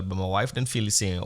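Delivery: ordinary delivery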